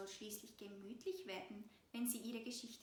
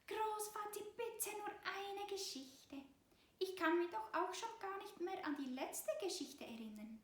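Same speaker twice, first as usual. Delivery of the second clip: put-on pleading mouse child; 8 semitones higher than usual. A narrator's reading. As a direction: plead with grandpa. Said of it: "Auch sie versucht ihr Bestes". (hohe, flehende Mäusekinderstimme)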